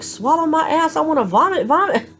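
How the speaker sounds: high, exasperated comeback; indignant retort. Retold or imitated. Patient retorting to the nurse, high and exasperated. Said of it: "I'm like".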